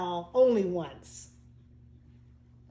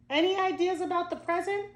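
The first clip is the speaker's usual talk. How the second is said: put-on child voice; 8 semitones higher than usual. A narrator's reading.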